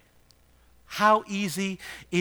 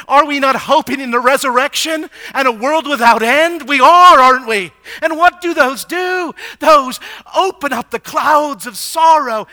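rising, louder pulpit climax; preaching at full force; 7 semitones higher, 12 dB louder than usual. Real, not acted. Pastor rousing mourners toward hope, loud and rising. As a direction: build the fervour line by line.

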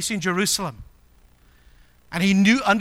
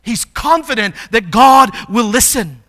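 raised, loud proclamation; emphatic preaching; 4 semitones higher, 8 dB louder than usual. Real, not acted. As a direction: declare it with full conviction. Preacher proclaims at full force.

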